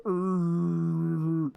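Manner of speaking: burning monster's pained groan. Retold or imitated. Corn monster on fire, groaning in pain. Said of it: "he's like".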